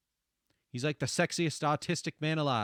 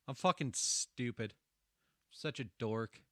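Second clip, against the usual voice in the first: normal talking voice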